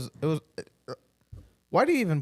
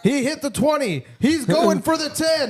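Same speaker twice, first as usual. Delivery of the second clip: higher, louder sportscaster build; mock play-by-play; 12 semitones higher, 5 dB louder than usual. Friend hamming up a football call, high and loud. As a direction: call it like a live commentator.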